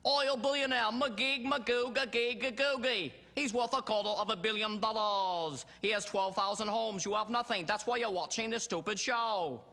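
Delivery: high, loud TV host bellowing every line; Robin Leach. Acted. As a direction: shout it out as an over-the-top celebrity show presenter. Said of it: "How about, uh, Robin Leach?".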